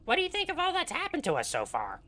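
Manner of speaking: high squeaky gnome; Grupham's gnome voice. Acted. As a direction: pitch way up like a little gnome. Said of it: "this is my gnome voice for Grupham".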